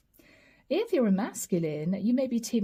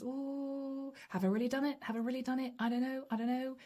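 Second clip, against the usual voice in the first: nervous; 4 semitones higher, 8 dB quieter, faster than usual. Retold or imitated. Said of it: "You're still there feeling a bit nervous".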